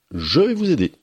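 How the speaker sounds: put-on a bright young princess offering help; high princess voice.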